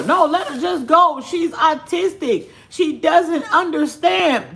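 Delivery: high mock-soothing parent voice; hammed-up permissive parent. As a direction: mimic the excuse, high and slow. Mocking the parents' excuse.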